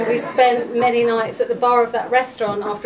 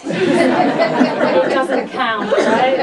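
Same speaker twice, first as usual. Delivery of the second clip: laughing through the words, slow and louder; 7 dB louder, much slower than usual. Real, not acted. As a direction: giggle out the joke as you say it. Joking aside at eulogy.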